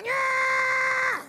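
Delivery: very high, loud squealing yell; a high, loud dummy cheer; ventriloquist dummy voice. Acted. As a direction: shriek it like a squeaky puppet. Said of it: "no, I do the voice".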